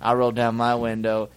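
usual speaking voice

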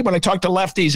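ordinary talk

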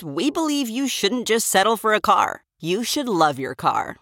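normal talking voice

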